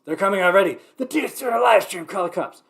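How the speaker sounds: hammed-up urgent tattling; mock alarmed neighbor. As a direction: raise the alarm as a fussy neighbor. Mocks neighbors calling the cops.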